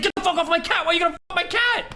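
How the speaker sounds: high, yelling imitation of an outraged woman; imitating a shout. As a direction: yell it in her outraged voice. She yells at cat attacker.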